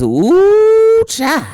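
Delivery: high swooping, long-held ooh; hammed-up hoodoo elder exclaiming; ancestor woman's voice. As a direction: imitate an amazed mama spiritualist. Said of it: "That's how she talked".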